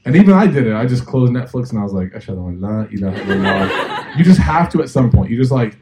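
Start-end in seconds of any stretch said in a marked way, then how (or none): 2.1-4.2 laughing mock prayer. Reciting shahada in turbulence, laughing.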